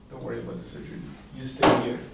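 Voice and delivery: whispered, no voice at all; whispered reassurance. Retold or imitated. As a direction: whisper it like a kind secret. Doctor's kind, confidential offer.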